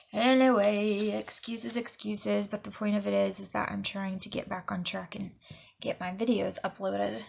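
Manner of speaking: usual speaking voice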